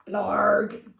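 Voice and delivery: loud monster grunt; creature noise. Acted. The something says goodbye with a loud creature grunt. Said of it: "said the something".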